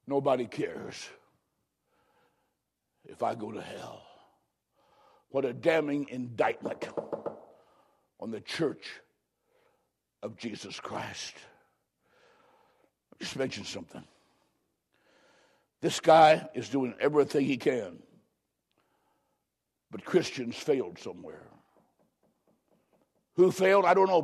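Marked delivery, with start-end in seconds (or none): 0.0-4.0 much lower and much softer, hopeless; hushed despair. Acted. Lost man realising nobody cares, hushed. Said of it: "the answer comes back". 5.3-21.5 grave hush. Real, not acted. Preacher mourning the church's failure, hushed.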